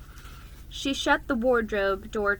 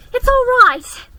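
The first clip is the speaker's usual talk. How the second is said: put-on high, loud childlike call; an eager little girl reassuring her siblings; high excited girl; 16 dB louder than usual. A narrator's reading. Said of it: "she repeated".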